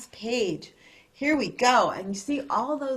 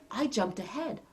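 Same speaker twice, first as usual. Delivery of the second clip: usual speaking voice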